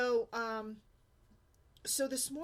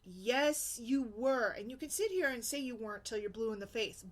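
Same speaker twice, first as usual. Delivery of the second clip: usual speaking voice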